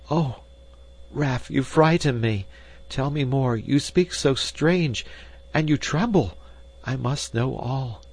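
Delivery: high-pitched, anxious pleading; frightened wife. A narrator's reading. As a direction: plead in alarm, voice lifted high. Frightened wife begs for more.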